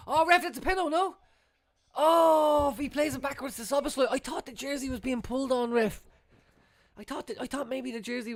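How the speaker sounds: high, urgent penalty plea; appealing to the ref. Real, not acted. Fan pleading with the ref for a penalty, urgent. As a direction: beg the referee for it.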